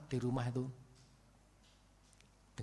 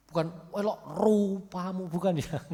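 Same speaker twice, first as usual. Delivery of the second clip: a higher, pushed, mocking reading of elok rupamu; wrong harsh intonation; 5 semitones higher, 3 dB louder than usual. Shown as a demonstration. Preacher shows the wrong way to read the verse, mocking. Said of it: "Itulah beda intonasinya".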